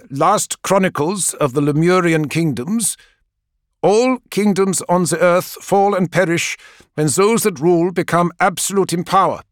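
high, solemn book reading; reading aloud. Acted. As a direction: read it out like a grave old text.

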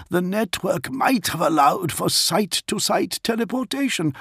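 polite high-pitched Warsmith addressing his lord; Dantioch's voice. A narrator's reading. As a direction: speak up high and careful as the loyal Warsmith. Dantioch ponders.

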